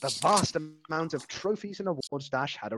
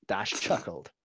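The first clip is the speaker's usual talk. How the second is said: ordinary delivery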